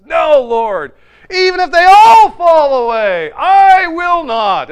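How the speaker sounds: high, booming shouted vow; full of bravado. Retold or imitated. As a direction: protest with macho certainty, slowing on the last words. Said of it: "with all the bravado and".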